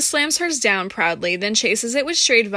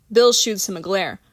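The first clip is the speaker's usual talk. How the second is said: ordinary delivery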